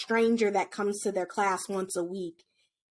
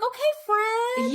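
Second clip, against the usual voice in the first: much higher, slow, sugary service voice; customer service voice; 12 semitones higher than usual. Acted. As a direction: sweet-talk the class like a shop clerk. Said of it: "put on my customer service voice".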